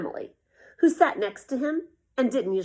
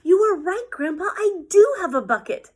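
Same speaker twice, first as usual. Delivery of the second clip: loud, higher happy shout; 8 semitones higher, 9 dB louder than usual. A narrator's reading. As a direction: burst in calling it out. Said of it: "he shouted".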